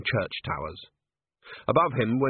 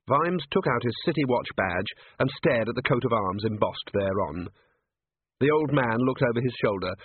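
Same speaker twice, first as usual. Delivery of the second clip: ordinary delivery, nothing marked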